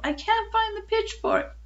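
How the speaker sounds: high-pitched holler without real volume; storyteller's mock yell; acted yelling down. A narrator's reading. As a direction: yell down from the hayloft, held back. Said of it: "yelling down at Missus Piggle-Wiggle".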